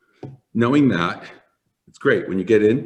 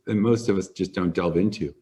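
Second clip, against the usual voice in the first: usual speaking voice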